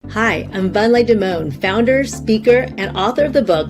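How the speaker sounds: ordinary talk, nothing marked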